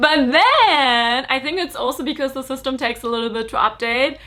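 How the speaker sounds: ordinary delivery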